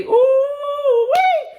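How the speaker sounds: high squealing ooh wee; excited whoop. Acted. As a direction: let out a high joyful whoop.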